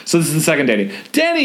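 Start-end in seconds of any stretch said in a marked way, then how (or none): 1.1-1.4 shouted name. A narrator's reading. Brother calling to little brother, high shout. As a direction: call out to him across the street. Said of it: "you shout".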